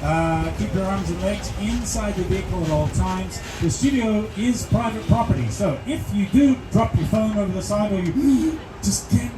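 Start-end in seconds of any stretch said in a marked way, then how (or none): none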